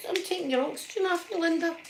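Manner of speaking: high, mocking imitation of a curt nurse. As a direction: mimic her blunt, snippy tone. Mimicking the nurse's blunt line.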